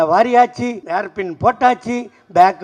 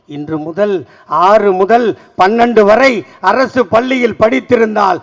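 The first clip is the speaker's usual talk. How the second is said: loud rhythmic declamation of questions and answers; ringing political platform speech; rousing stump oratory; 4 semitones higher, 5 dB louder, slower than usual. Acted. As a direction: build it like a rally litany. Formal proclamation to the crowd.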